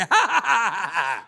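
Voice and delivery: loud amused laugh. Real, not acted. Laughs at full plaza.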